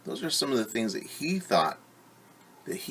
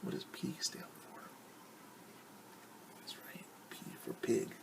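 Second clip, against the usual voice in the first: whisper; 16 dB quieter than usual. Real, not acted. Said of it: "quiet question to the kids".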